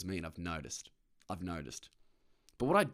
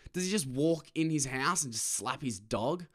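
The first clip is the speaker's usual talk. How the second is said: ordinary talk, nothing marked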